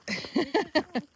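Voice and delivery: bright amused laugh; a quick happy laugh. Real, not acted.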